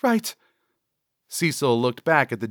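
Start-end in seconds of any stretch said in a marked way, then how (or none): 0.0-0.3 dim sidekick pitched way up; high-voiced sidekick. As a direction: answer in a high, unsure character voice. Cecil obeys.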